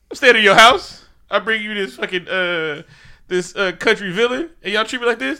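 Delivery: loud, high indignant complaint; mock outraged guest; angry rant. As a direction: rant like you've been disrespected. Angry Killmonger retold quote.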